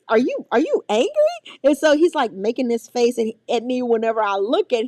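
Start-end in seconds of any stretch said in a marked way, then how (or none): 0.1-1.6 playful aunt. Retold or imitated. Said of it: "And I'm like".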